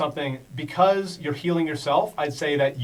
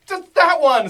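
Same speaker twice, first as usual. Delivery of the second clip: high, loud creature picking its target; monster's choice; 13 semitones higher, 10 dB louder, slower than usual. Acted. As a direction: squeak it out high and loud, pointing. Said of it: "she says".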